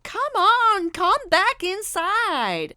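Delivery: high, loud, slow coaxing call; old woman calling to the fleeing lady; older lady pleading. A narrator's reading. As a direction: call her back inside, worried. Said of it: "said one of the older ladies".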